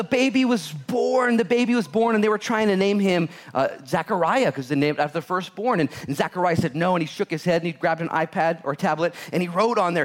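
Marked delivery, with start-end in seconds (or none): none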